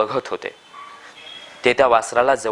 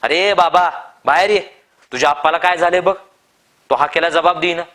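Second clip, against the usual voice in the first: higher, louder urgent shouting; alarmed shout; 7 semitones higher, 6 dB louder than usual. A narrator's reading. Farmer shouting at Bhavdya's door, urgent loud yell. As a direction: yell for the neighbour to come out. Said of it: "तो ओरडला".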